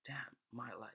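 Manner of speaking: whispered, letting it sink in; hushed realization. Acted. Man absorbing God's joke about his life, hushed. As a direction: say it under the breath.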